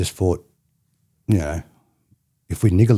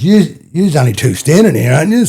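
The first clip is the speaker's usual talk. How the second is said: much higher and louder hammed-up challenge; mock cop challenge; 7 semitones higher, 7 dB louder than usual. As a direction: bark it like a bossy cop. Cop confronting boys.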